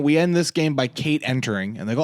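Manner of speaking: ordinary talk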